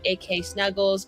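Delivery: ordinary delivery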